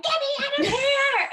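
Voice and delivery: high, loud panicked yell; mock scream. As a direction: scream it like a terrified roller coaster rider. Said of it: "you were just screaming".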